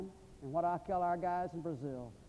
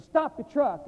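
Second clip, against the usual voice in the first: sharp loud command to stop; sudden loud stop order from the back seat; barked order; 8 semitones higher, 10 dB louder, much slower than usual. Retold or imitated. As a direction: call out for the truck to halt, loud. Man orders the driver to stop.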